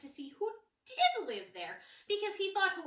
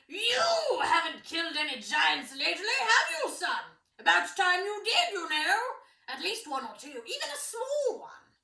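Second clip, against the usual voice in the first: booming, mocking father voice, much higher and louder; loud teasing king; 5 semitones higher, 8 dB louder than usual. King taunts his son over lunch, loud and mocking. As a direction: needle the son like a jovial bully king.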